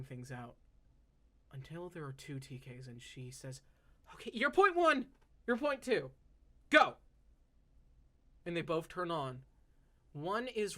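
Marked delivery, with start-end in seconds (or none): 4.1-7.0 eager command. Acted. Elf launches two constructs.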